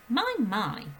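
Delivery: corrected into Miss Honey's voice; mild, kindly "My, my". Shown as a demonstration. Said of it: "That was Miss Honey that said that".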